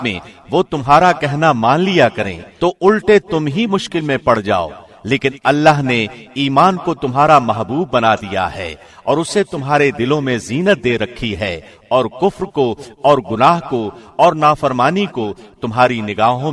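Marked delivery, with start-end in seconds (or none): none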